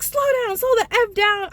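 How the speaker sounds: high, loud imitation of angry yelling; retold yelling. Drunk boyfriend to speeding driver, high retold yell. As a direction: yell it high as the alarmed passenger.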